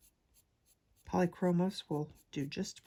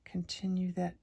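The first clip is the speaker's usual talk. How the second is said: ASMR whisper. Real, not acted.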